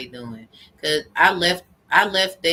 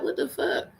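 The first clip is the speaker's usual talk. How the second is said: shocked whisper; 9 semitones higher, 4 dB quieter than usual. Retold or imitated. Shocked at the omen.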